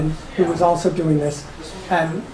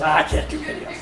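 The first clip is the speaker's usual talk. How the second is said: half-whispered retold refusal; quiet refusal; 3 semitones lower, 5 dB quieter than usual. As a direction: breathe it out with a laugh. Retold refusal of friend's dare.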